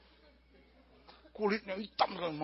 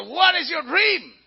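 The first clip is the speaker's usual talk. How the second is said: loud, slow, high mock question; questioning wise men; 5 semitones higher, 10 dB louder, much slower than usual. Wise men asking the king his dream, loud comic questioning. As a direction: ask it with exaggerated, drawn-out English. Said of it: "Orang tanya".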